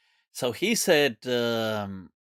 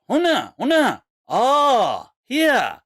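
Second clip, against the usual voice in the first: high, loud, swooping calls; put-on discovery; 9 semitones higher, 6 dB louder, much slower than usual. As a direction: mimic a hammed-up show of pointing out the spot. Driver pretends to identify drop-off place.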